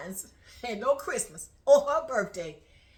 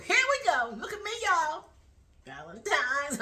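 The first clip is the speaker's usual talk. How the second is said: showing off; 3 semitones higher than usual. Acted. Woman posting her ring on Facebook, excited showing off.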